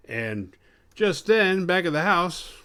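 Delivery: high, loud, drawn-out announcer narration; dramatic scene change. Pretend. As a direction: belt out the cliffhanger like a narrator.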